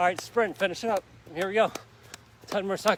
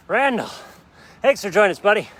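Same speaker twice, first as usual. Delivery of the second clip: loud, higher friendly shout across the park; calling out to a friend; 3 semitones higher, 14 dB louder than usual. Real, not acted. Trainer calls out loudly and higher to Randall passing by. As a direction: hail him from a distance.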